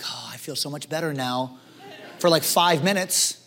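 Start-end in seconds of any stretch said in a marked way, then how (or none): 0.0-1.6 mock relief. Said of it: "you're like".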